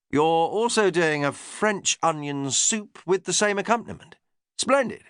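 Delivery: a hint of an eager upper-class lord; English lord. Lord Errington asking his chef about foraging, lightly posh. James's voice.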